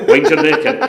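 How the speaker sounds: hammed-up director's rebuke; mock stern warning. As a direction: lean on it harder. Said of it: "the local director going".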